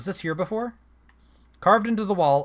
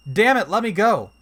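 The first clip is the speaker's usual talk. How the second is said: straining to yank her hands free; trapped girl shouting at a statue; angry shout; 4 semitones higher, 6 dB louder than usual. Acted. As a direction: Kid yells, louder and higher. Kid bitten, struggling.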